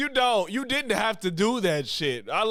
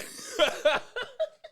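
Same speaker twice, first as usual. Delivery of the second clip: high laugh rising at the end; high laughter. Real, not acted. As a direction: giggle up high. Still cracking up.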